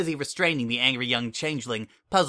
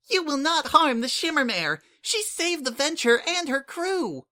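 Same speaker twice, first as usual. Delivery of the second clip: fierce defense. Acted. Changeling guarding Sunset from arrest, high fierce protest. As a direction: plant yourself and refuse, shrill with loyalty.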